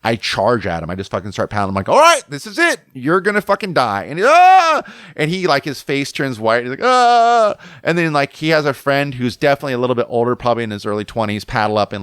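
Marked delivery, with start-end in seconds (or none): 1.9-4.1 loud, high battle yell, retold; acted death threat, starting at a shout; charging threat. 4.3-4.8 loud, high imitated scream; acted-out yelp of terror; mock terrified scream. 6.8-7.6 loud, high panicked "ah"; acted-out cry of fright; mock terrified scream.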